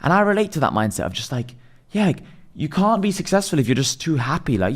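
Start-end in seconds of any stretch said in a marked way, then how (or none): none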